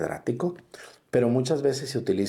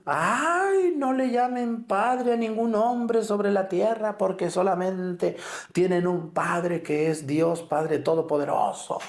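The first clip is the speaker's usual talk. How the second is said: much higher, hammed-up indignant cry; mocking, high-pitched scandalized wail; mock outraged protestant; 8 semitones higher than usual. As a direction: parody the outcry of an offended protestant. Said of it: "entonces sí viene el griterío".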